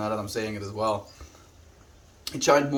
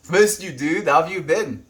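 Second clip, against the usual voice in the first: excited greeting; 6 semitones higher, 6 dB louder than usual. Real, not acted.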